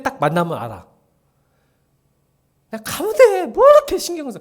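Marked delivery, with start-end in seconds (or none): 2.7-4.4 high, loud exasperated shout. Real, not acted. Pastor yelling at the church to stop overthinking. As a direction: yell it to shake them out of worrying.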